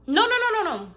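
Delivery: high, loud rapid-fire no's; emphatic interruption. Real, not acted. Storyteller stopping herself mid-tale, emphatic interruption. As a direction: cut yourself off, high and loud.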